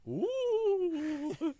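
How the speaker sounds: high eerie genie ooh. Acted. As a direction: moan it high and ghostly. Genie awakening voice.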